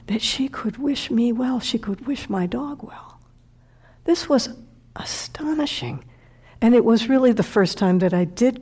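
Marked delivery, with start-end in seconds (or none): 0.1-3.2 softer, whispered phrase; real emotion pulls the voice to a whisper; hushed emotion. Real, not acted. Speaker moved, dropping to whisper. 4.0-6.0 whispered, high astonishing; awed whisper. Real, not acted. Speaker in awe at the kindness.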